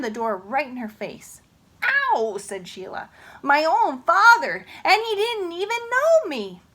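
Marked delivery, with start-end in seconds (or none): 1.8-2.4 ow. Acted. Sheila hurt. 3.4-6.6 dismayed wail. Acted. Sheila dismayed.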